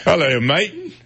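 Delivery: a mock Australian greeting pitched high; Australian accent. Shown as a demonstration. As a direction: try an Australian hello. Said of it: "so that was Australian".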